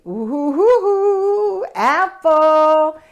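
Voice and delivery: thrilled at the juicy apple; excited reveal. Shown as a demonstration. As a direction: build delight to the reveal of apple. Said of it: "Big, juicy".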